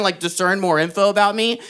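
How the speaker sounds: ordinary talk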